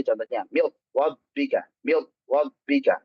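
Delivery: "wabbika" with w in place of r; r turned to w. Shown as a demonstration. Teacher imitating a common mistake. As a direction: say the r as a plain w.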